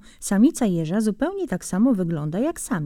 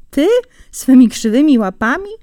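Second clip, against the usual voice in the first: scornful disbelief; 5 semitones higher, 7 dB louder than usual. Acted. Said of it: "rzekł zając".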